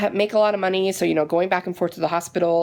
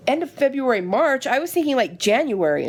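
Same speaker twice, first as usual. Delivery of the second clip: incredulous; 6 semitones higher than usual. Retold or imitated. Disbelief at the surgery date.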